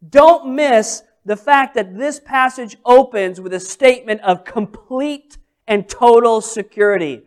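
ordinary delivery

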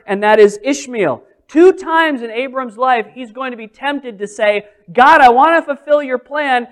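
loud, driving sermon delivery; forceful preaching. Acted. Preacher pressing the point to the congregation, loud.

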